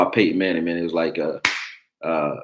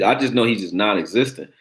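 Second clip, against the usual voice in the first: normal talking voice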